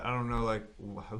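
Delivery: ordinary talk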